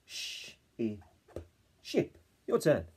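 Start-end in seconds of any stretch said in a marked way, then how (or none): 0.1-1.0 "Sh-, I" each sound held separately. Shown as a demonstration. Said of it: "My turn".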